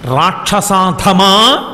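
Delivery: రాక్షసాధమా spoken very slowly, every syllable stretched; drawn-out insult. Acted. Sita calling Ravana రాక్షసాధమా, slow and drawn out. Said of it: "అని పిలిచింది ఆవిడ".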